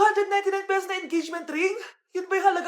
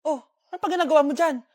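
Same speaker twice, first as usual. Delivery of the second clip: ordinary delivery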